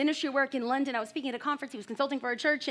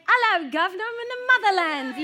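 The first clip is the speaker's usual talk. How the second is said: lightly hammed-up posh British, a bit higher; mock British accent; 6 semitones higher, 3 dB louder than usual. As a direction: play the proud new Brit in a hint of British accent. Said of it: "So I was like".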